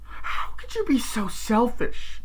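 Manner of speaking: high-pitched mock reproach; imitated accusation. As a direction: act out a daughter's wounded challenge. Acted accusation from daughter.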